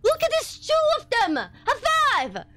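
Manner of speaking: high, loud burst of triumph; excited celebration. Real, not acted. Thrilled by lucky loot find.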